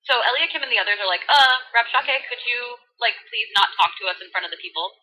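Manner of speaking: put-on bubbly valley girl voice. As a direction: read scripture like a valley girl. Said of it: "Valley Girl edition".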